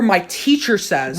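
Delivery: ordinary delivery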